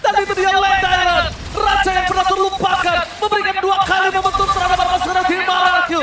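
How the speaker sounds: loud, high esports hype; hype caster shout. Acted. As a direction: shout it like a big play call.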